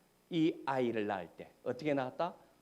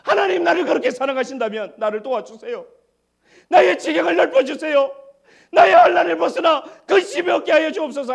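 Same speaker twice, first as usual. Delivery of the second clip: much higher and louder pleading cry; fervent shouted prayer; 17 dB louder than usual. Acted. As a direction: pray at the top of your voice. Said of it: "야베스가 뭐라고 기도합니까".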